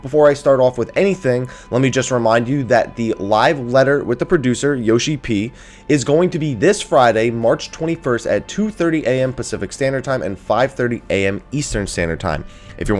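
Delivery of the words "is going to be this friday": ordinary talk, nothing marked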